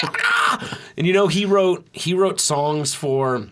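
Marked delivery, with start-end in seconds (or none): 0.0-0.8 high, unvoiced shriek; breathy shriek. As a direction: let out a wild breathy yelp. Ends the poem impression.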